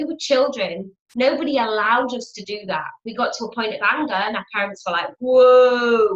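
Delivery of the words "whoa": loud, startled parental whoa; parental shout. Retold or imitated. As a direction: stop the child with a shout. Parents shutting down anger.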